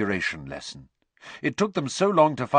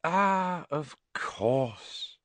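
knowing; 6 semitones higher, much slower than usual. Acted. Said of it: "suddenly frowning".

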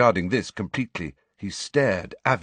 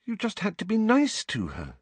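anxious; 14 semitones higher than usual. A narrator's reading. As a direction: urge it anxiously, voice lifted high. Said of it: "looking up anxiously".

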